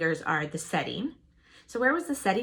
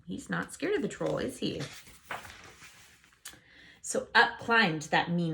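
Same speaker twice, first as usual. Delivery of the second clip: ordinary delivery, nothing marked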